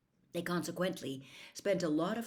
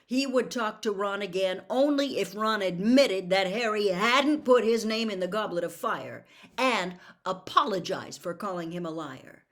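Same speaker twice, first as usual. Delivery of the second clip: ordinary delivery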